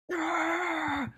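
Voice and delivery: high but soft mock scream; small, high, held squeal of frustration; pissed-off toddler scream. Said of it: "She would get so pissed".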